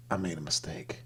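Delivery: soft, deflated mock regret; sinking regret. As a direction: say it quietly as reality sinks in. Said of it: "the reality sets in".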